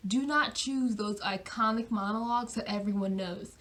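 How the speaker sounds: normal talking voice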